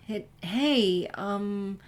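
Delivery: hesitant, halting start; awkwardly. A narrator's reading. Twilight nervously asking Sunset for help, awkward and halting. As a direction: trip over the opening. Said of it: "began Twilight awkwardly".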